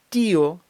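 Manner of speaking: «тіо» with long, stressed і, separate о; split diphthong. Shown as a demonstration. Teacher shows how it should sound, vowels split. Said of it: "щоб воно звучало як".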